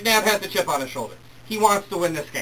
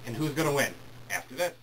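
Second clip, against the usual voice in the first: normal talking voice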